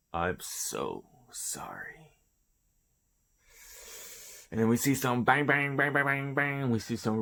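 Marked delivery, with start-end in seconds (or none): 0.1-2.2 slow, soft whisper; guilty whisper to his lord; whispered apology. Acted. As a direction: breathe the apology, barely voiced. Orochi apologises as doom falls.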